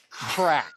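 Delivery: sharp imitated crack of impact; cracking impact noise. Dad acting out daughter's face hitting his knee, sharp crack. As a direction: punch out a sudden crack sound.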